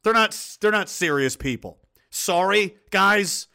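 usual speaking voice

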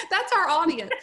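laughing it out the second time. Real, not acted. Host laughing as she repeats who the audience is, amused.

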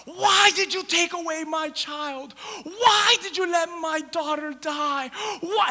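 acted anguished wail, slow and high; drawn-out, tearful cry of protest; screaming at God. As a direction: cry out to God in grief and rage. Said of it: "screaming at God".

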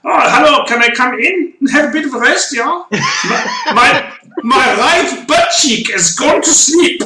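hammed-up imitation of a complaining driver; driver on team radio. Driver asking to pit with a numb backside, high mock complaint. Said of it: "He came on the radio and said".

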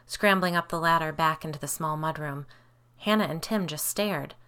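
ordinary delivery, nothing marked

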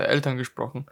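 ordinary talk, nothing marked

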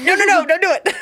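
high squealing no no no; mock-panicked stop; playful mock warning. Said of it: "almost slapped Kate's hand".

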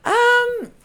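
loud, very high drawn-out hesitation; awkward stalling. Retold or imitated. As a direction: stall with a squeaky, exaggerated um. Retold hesitation at second-date request.

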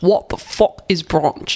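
slow mock strong Cockney accent. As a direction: imitate a thick Cockney accent, drawn out. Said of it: "I think it must be some kind of Cockney".

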